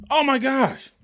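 high, exasperated outburst. Real, not acted. To sports fans about debate shows, high exasperation. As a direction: throw it up high in disbelief.

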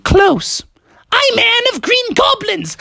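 very high, shrieky goblin reveal; goblin villainess. Acted. Maiden reveals she is Anne of Green Goblins, high wicked cackle. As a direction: cackle after a gleeful villain announcement.

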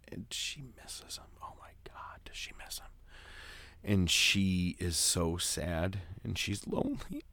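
low, hushed voice fading into a whisper; mock emotional. Podcaster telling co-host about lonely Liz on the swing, mock choked-up whisper. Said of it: "I'm gonna get emotional here".

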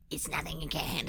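soft, quick grunted monster voice; grunting boogeyman. Shown as a demonstration. Reader shows how the boogeyman should speak, soft grunting voice. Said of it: "He has to speak in grunts".

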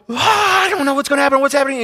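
high-pitched panicked wail; acted inner panic. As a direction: wail it high, as if screaming inside. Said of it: "I'm just panicking".

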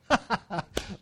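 quick chuckle mid-sermon; short laugh. Real, not acted. Preacher amused at where this is going, short laugh. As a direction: laugh to yourself, pleased.

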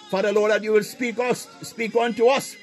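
normal talking voice

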